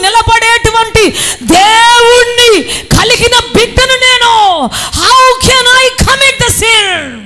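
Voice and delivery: high, slow, ringing shout; loud declaration. Acted. Joseph refusing the temptress, a slow ringing shout. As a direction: proclaim it with full force, drawn out. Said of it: "చెప్పాలి గట్టిగా".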